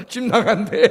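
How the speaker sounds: laughing. Retold or imitated. Laughing retelling little sister.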